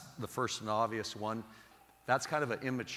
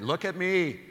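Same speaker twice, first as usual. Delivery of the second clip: higher, louder self-important mock voice; mocking a loud phone talker; 7 semitones higher, 6 dB louder than usual. Mocking the loud woman on her phone, higher and louder. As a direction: ham up the me-me-me phone caller. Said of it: "one of those kind of people that".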